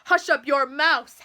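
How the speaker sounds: loud angry howl; howled order. A narrator's reading. King silencing the complaining turtle, loud howl. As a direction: shout him down from above. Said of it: "howled the mighty King Yertle".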